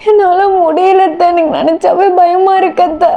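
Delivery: crying and frightened, pitched high; acted tearful fear. A narrator's reading. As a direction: plead helplessly through tears. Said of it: "பயங்கரமா அழுதுட்டு இருக்கா".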